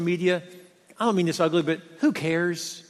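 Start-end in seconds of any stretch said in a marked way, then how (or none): 1.6-2.8 sincere shrug. Real, not acted. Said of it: "I mean that in a sincere way".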